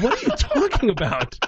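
high-pitched appalled outburst; exasperated disbelief. Retold or imitated. Comic answering the football helmet question, high exasperated protest.

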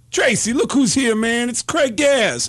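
high-pitched imitated call to a friend; excited calling out. Opening act presents his friend offstage, imitated excited call. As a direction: play a buddy announcing a surprise guest. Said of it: "and Artie goes".

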